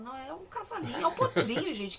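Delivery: rindo, protestando com carinho; retold protest through a laugh. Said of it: "Eu falava". (falando rindo, defendendo o potrinho)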